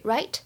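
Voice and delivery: "right" with an upward tune; rising tag question. Shown as a demonstration. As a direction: lift "right" to ask for agreement. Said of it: "会有一个语调的上扬". (句末“right”升调，征求赞同)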